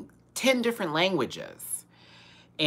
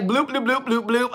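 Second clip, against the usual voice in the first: mock gibberish; 10 semitones higher than usual. Woman imitating the gibberish she heard in the car, high mock babble. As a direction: parody foreign speech as silly high bloops. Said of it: "I heard gibberish".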